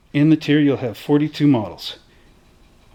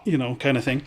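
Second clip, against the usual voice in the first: usual speaking voice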